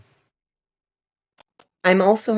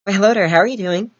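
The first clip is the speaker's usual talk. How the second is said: higher, brisk and bright-toned greeting; natural higher voice; 5 semitones higher, 5 dB louder, faster than usual. Shown as a demonstration. Showing a friend his unlowered voice, higher. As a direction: let the voice float up to its natural height. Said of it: "this is my normal voice if I'm not forcefully lowering it".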